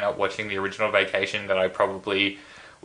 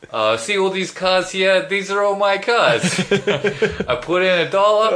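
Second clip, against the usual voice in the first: high, slow Brooklyn hustler voice; Brooklyn accent; 10 semitones higher, 5 dB louder, slower than usual. Retold or imitated. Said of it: "he starts talking with his Brooklyn accent".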